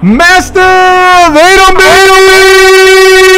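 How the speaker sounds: very high, loud, stretched-out victory call; long drawn-out ring announcer roar; winner announcement. Acted. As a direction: hold each word like announcing a champion.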